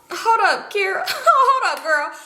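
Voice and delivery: high-pitched, cracking up in disbelief; laughing disbelief. Retold or imitated. Stopping a friend mid-confession, laughing high disbelief. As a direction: halt her through laughter, voice way up.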